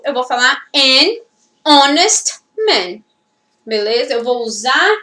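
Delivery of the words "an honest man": "an honest man" slowly, word by word, no H; silent H. Shown as a demonstration. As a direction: spell out "an honest man" by ear, "honest" vowel-first. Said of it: "se eu tiver que falar". ("an honest man" pausado, com H mudo)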